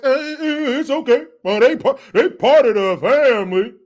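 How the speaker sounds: high, loud mocking politician impression; Barry Obama. Host mimics politician telling a crowd it's okay, mocking impression. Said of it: "Now you hear his voice".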